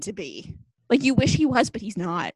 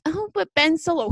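mocking fans; 6 semitones higher than usual. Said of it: "And then they're like".

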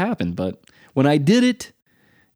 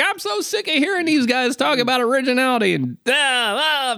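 higher, whiny fed-up listener voice; mock bored listener; 13 semitones higher than usual. Podcast listeners complaining about the long talk, mock whiny. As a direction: whine it like a listener sick of hearing it. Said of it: "They're like".

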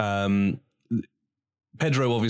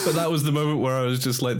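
ordinary talk

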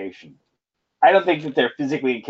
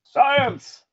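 mock excited cry; 14 semitones higher than usual. Co-host exclaims in mock excitement.

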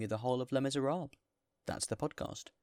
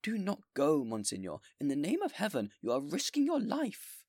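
high, loud begging; desperate plea; 7 semitones higher, 8 dB louder than usual. Acted. Mayor begging the bishop not to go, loud. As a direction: plead with him in desperation.

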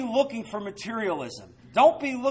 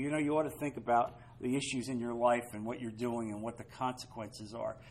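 much softer, brisk gentle advice; caring, gentle counsel; 8 semitones lower, 10 dB quieter than usual. Pretend. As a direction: speak it kindly like a concerned friend. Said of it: "you need to speak in love with a caring attitude".

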